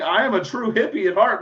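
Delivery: normal talking voice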